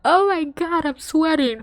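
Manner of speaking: high-pitched overwhelmed fangirling; flustered freak-out. Real, not acted. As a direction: let the voice shoot up, overcome with excitement.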